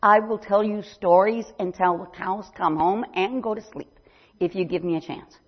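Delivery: usual speaking voice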